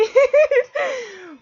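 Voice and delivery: sudden high loud burst of laughter; loud giggle after confessing tears; embarrassed laugh. Real, not acted. Laughs after admitting she cried.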